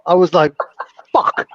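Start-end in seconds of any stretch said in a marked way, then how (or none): 1.1-1.6 a mortified curse breaking into laughter; swear, then crack up laughing. Retold or imitated. Caught bent over by the doctor, laughing through the curse.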